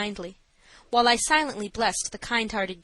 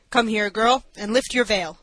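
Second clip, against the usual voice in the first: loud, harsh command; stern judge calling across the room; 6 dB louder than usual. A narrator's reading. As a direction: call out sharply to summon someone. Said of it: "in tones which surprised me by a harshness".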